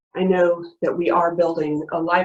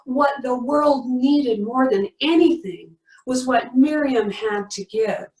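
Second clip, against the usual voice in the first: ordinary talk, nothing marked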